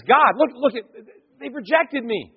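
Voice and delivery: high-pitched wounded complaint; Samuel. Acted. Samuel complaining to God of rejection, high wounded plea. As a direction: plead upward, stumbling as if hurt. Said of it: "and Samuel says".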